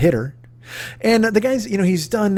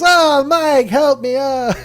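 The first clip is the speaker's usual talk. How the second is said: high, whiny plea for help; lightly mocking high plea for help; Davy pleading; 9 semitones higher, much slower than usual. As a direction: play Davy begging Mike. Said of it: "Davy goes".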